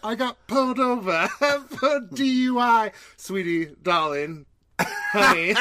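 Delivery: put-on giggly British accent slipping toward Australian; put-on British, breaking into laughter. Podcast host struggling through a skit in shaky-with-laughter British accent. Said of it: "my British accent starts turning into Australian".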